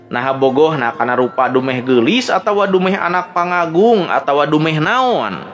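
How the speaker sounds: ordinary delivery, nothing marked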